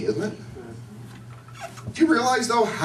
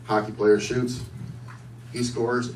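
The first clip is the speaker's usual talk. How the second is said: ordinary delivery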